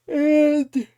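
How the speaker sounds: words stretched by a yawn. Real, not acted. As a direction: yawn through the word. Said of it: "sorry for the yawn".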